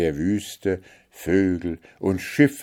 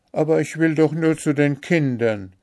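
pleading; 9 semitones higher, 3 dB louder, faster than usual. A narrator's reading. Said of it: "bat Märchen".

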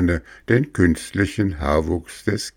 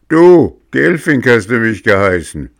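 much higher and louder furious accusation; angry shout; 4 semitones higher, 7 dB louder than usual. A narrator's reading. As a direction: yell it at the carpenter. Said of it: "fuhr den Schreiner wütend an".

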